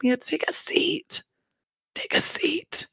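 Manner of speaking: high, slow squeaky whisper; hushed, high-pitched shade; mock whisper. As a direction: whisper the dismissal, drawn out and high. Mock hushed dismissal of Mia.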